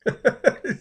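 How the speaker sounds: real high burst of laughter; laughing. Real, not acted. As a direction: laugh at something absurd. Laughs at the played clip.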